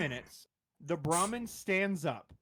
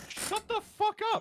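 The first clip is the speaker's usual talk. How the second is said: much higher, shouted in mock outrage; playful yell. Real, not acted. GM denying the player's crit, mock outraged shout. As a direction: bark it up high at a friend.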